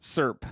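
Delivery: serp clipped to one syllable, like slurp; mimicking serp. Husband mimicking his wife's serp. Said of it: "you say s".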